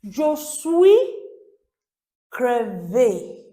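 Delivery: "Je suis crevé" slow and emphatic, a pause before "crevé"; model pronunciation. Shown as a demonstration. French teacher introducing a phrase, "Je suis crevé" said slowly and clearly. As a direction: say "Je suis crevé" loudly, "suis" rising, "crevé" falling. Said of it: "master your pronunciation".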